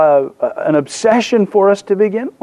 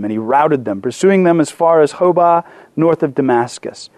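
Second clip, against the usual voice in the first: lower, measured Bible reading; scripture reading; 4 semitones lower than usual. Acted. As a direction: read the passage aloud with steady weight. Reads scripture to congregation.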